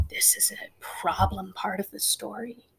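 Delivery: soft hushed whisper. Real, not acted. Teacher whispering the problem part of the story to the class. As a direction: whisper it like a secret. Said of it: "whispered storytelling to class".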